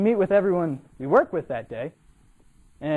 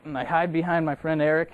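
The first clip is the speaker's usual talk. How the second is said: ordinary delivery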